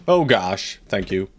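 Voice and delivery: ordinary delivery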